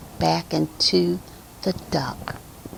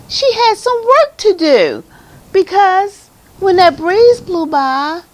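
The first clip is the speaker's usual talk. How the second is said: gushing enthusiasm; 12 semitones higher, 5 dB louder than usual. Real, not acted. Reader telling children about the surprise ending, gushing enthusiasm. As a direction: bubble over with delight, pitched way up.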